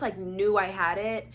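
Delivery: ordinary talk, nothing marked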